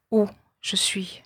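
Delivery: chuchoté, chute finale; whisper. Acted. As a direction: souffle la question vers le bas. Question murmurée du poème. (murmure descendant)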